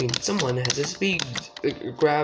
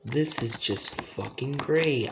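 ordinary delivery

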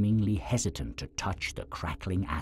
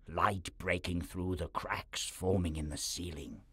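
ordinary delivery

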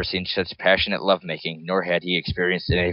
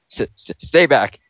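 scared stammer; 7 semitones higher, 6 dB louder than usual. Acted. Cornered by Charles against cliff.

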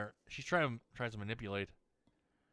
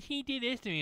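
mimicked whiny child; 13 semitones higher than usual. Mimicking little girl's false accusation.

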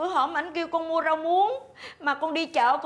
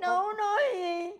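softer tearful denial; crying; 4 dB quieter than usual. Acted. Wife denying to her husband while crying softly. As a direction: whimper it through tears. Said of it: "Nín, nín con".

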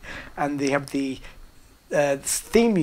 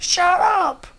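sudden loud barked shout, much higher; 7 dB louder than usual. Retold or imitated. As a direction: yell it to cut him off. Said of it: "And I shout".

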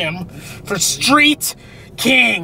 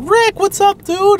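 friendly greeting; 12 semitones higher, 4 dB louder, slower than usual. Retold or imitated. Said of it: "everybody was like".

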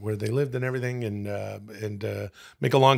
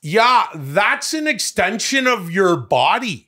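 slow, higher, louder jokey Canadian voice; mock Canadian; 8 semitones higher, 5 dB louder, slower than usual. Echoing his friend's line in an exaggerated Canadian accent. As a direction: drag it out in a hammed-up Canadian accent. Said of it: "said that in Canadian".

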